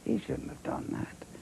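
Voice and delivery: a sudden high breathy whisper, no voice at all; whispered aside. Retold or imitated. Fuming on the walk home, he whispers to himself. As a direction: hush it like a secret grievance.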